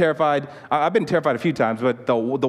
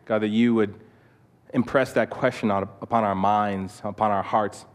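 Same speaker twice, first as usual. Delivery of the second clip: closing prayer; 2 semitones lower than usual. Real, not acted. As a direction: pray gently on the group's behalf, leaving space between phrases.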